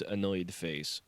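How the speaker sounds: plain reading voice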